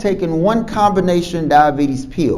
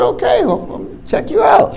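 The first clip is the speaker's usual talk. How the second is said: much higher, teasing falsetto; playful tease. Retold or imitated. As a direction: tease her in a high, breathy voice. Doctor teasing about the guy.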